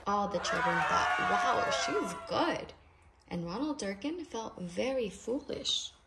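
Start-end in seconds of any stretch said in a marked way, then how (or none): none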